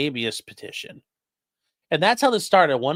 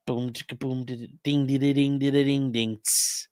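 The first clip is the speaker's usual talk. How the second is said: beatbox it under your breath; beatbox hook. Pretend.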